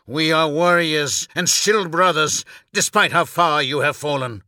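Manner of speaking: forceful retort. Acted. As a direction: answer back with conviction. Brother answers the outburst forcefully.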